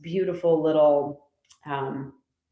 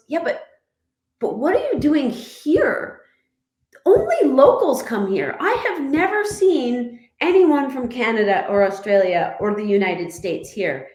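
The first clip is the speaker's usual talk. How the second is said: high, incredulous questioning; mock astonished local, higher and louder; local's disbelief; 6 semitones higher, 3 dB louder than usual. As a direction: act amazed anyone found the place. Quoting local man's disbelief.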